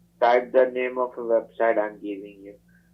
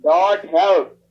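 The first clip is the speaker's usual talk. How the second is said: louder and higher, insistent; 5 semitones higher, 6 dB louder than usual. Real, not acted. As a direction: say it again, louder and firmer. Repeating it louder after confusion.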